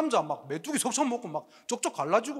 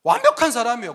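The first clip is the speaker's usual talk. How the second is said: ordinary talk, nothing marked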